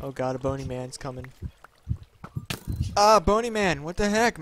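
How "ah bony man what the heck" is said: loud, higher outcry; raised-voice exasperation; startled protest. Real, not acted. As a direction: yell at the attacker in protest. Attacked by skeleton in game.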